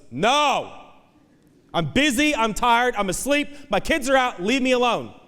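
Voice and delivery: high-pitched exasperated brush-off; annoyed refusal. Acted. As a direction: turn away a midnight caller, pitched high. Irritated neighbor refusing from inside.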